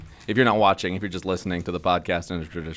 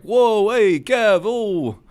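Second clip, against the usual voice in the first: put-on loud, high Philly accent greeting; brash Philly accent hey Kev; 12 semitones higher, 6 dB louder, much slower than usual. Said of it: "I can't do the Philly accent".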